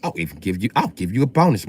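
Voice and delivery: ordinary delivery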